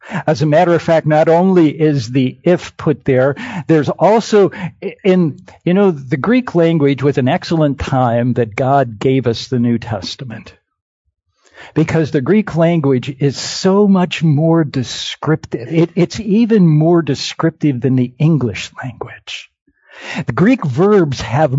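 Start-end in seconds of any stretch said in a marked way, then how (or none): none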